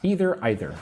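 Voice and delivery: first either as EE-ther, second either as EYE-ther; either with EE, then either with EYE; two pronunciations. Shown as a demonstration. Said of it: "Both are okay".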